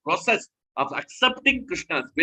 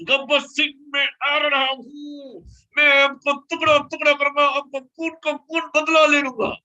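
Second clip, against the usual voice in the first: much higher, fast, hammed-up Bollywood hero threat; Dharmendra film hero; 10 semitones higher, 3 dB louder, much faster than usual. Preacher mimicking Dharmendra vowing revenge on Gabbar Singh, hammed-up and fast. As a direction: ham it up like a vengeful film hero. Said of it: "He will talk like that".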